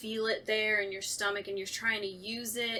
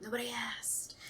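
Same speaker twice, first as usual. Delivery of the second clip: wry whisper; self-mocking whispered aside; 8 dB quieter than usual. Real, not acted. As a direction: whisper it to yourself. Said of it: "Nobody asked you this yet".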